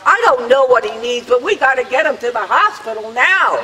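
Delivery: high, loud, urgent insistence; acted-out yell. Attendant on the stairs insists on the hospital, yelling. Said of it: "yelled out".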